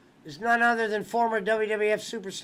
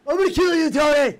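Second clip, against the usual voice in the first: loud, higher mock death threat; 4 semitones higher, 7 dB louder, faster than usual. Podcast host imitating Punk threatening Tony Khan, mock yell. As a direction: yell it like a wrestler threatening his boss.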